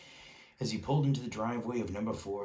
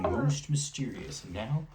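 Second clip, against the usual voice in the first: amused newsreader; 6 semitones higher, 5 dB louder than usual. A narrator's reading. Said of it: "allowed himself a grin".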